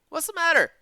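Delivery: high, startled captor voice; a captor leaning in, alarmed; a surprised kidnapper asks; captor asking. Acted. Captor voiced in read story.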